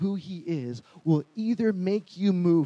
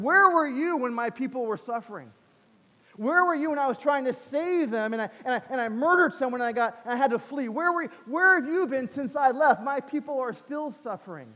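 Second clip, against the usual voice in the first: high, pleading challenge; pained accusation; 5 semitones higher than usual. Retold or imitated. Moses asking God where he was while his people suffered, pained accusation. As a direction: demand an answer, stumbling over the grievances.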